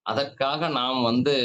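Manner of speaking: ordinary talk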